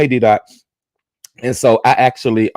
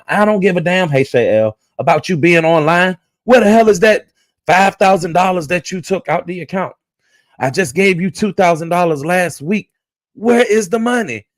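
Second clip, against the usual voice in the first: angry husband; 8 semitones higher than usual. Retold or imitated. Husband yelling at Skillet over the missing five thousand.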